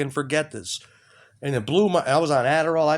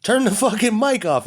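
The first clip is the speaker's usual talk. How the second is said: high, loud order to kill the mic; angry demand; 8 semitones higher, 5 dB louder than usual. Retold or imitated. Telling the phone listener to stop, loud and angry. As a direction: bark it at the phone like a command. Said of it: "I'm like".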